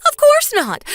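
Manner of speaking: high, loud yelp of denial; indignant protest. Acted. Mother-in-law denying a trick to Pasqua, high indignant protest. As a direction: jump up in pitch to protest.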